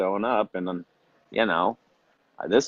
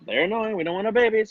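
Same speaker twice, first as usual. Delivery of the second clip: high-pitched mock parent; 13 semitones higher, faster than usual.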